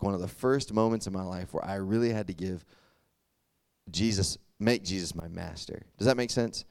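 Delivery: normal talking voice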